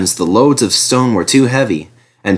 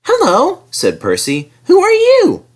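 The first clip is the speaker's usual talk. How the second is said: much higher, chirpy small engine; little engine voice. Acted. Percy grumbles at the helicopter in his high voice.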